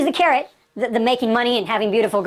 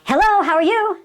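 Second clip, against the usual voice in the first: upbeat greeting; 8 semitones higher, 3 dB louder than usual. Shown as a demonstration. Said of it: "pick up the phone and say".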